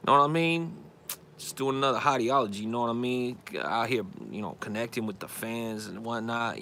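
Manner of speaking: hammed-up laid-back rapper talk; mock hip-hop swagger; little rapper persona. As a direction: play a pretend rapper floating on lean. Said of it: "this new persona".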